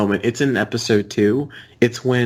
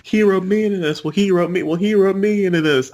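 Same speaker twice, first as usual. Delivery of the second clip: higher-pitched cartoon Southern accent; 8 semitones higher than usual. Quoting cartoon characters to friends, in a high mock Southern accent. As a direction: put on a raised, weird Southern twang. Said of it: "that weird Southern accent they all have".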